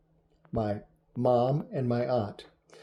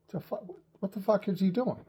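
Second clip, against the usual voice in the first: high-pitched mock bewilderment; stunned onlooker stumbling over the words; shocked disbelief; 7 semitones higher, 4 dB quieter than usual. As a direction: blurt it haltingly, aghast, pitched up. Family shocked by shotgun shots.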